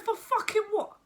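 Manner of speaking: high, loud incredulous yelp; outraged disbelief. Retold or imitated. Mother told of court by solicitor, high outraged disbelief. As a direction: fire it back high and indignant.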